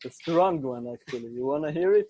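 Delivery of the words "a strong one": ordinary delivery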